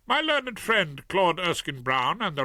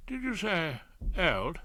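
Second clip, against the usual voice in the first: slow, higher put-out question; miffed; 6 semitones higher than usual. A narrator's reading. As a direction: sound piqued at the word old. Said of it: "Claude sounded miffed".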